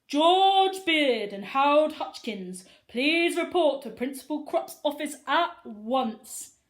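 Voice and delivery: loud, slow school PA voice; loudspeaker announcement. Acted. School office calling the boys, loud PA announcement.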